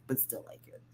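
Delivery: breathy whisper, still pleading; soft whispered aside; whispered plea. Retold or imitated. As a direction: whisper it like a secret request.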